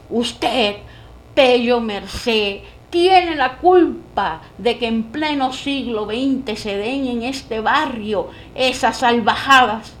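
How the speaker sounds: loud furious rebuke; angry shout. Acted. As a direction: scold him at the top of your voice. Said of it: "a voz en cuello". (increpando a voz en cuello)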